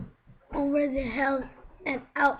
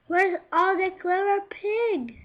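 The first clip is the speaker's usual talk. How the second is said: high pig voice; 7 semitones higher, 4 dB louder than usual. Acted. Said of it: "asked the clever pig".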